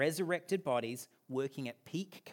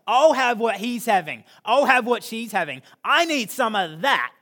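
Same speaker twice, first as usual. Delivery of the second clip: loud, high, eager voice of someone wanting in; eager onlooker; 6 semitones higher, 11 dB louder than usual. Retold or imitated. Churchgoer voicing an eager newcomer, loud and high. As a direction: call out like a hungry customer. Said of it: "like Pastor Jen said last week, they'll say".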